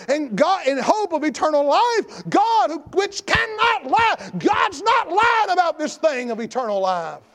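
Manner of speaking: high, forceful pulpit shout; preaching at full force. Acted. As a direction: drive each point home to the congregation.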